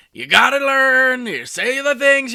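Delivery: loud, high, badly faked politician impression; Donald Trump. Showing a not good impression, loud and high. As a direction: push it up high and loud, hammed-up mimicry. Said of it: "It's not a good Trump impression".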